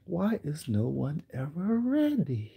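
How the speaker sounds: slow, quiet, lightly voiced icy boss; a touch of weary disdain; understated cold editor; Miranda voice. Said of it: "In my Miranda voice".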